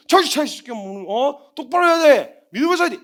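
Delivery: loud, high scolding bark; mock scolding. Preacher scolding a believer, loudly. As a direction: scold them sharply. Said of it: "이렇게 안 해요".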